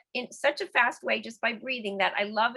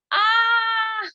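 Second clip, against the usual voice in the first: loud high ah then a laugh; bright ah sound breaking into laughter; 12 semitones higher, 6 dB louder than usual. Shown as a demonstration. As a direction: sing out ah, then burst out laughing. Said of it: "with a little ah sound".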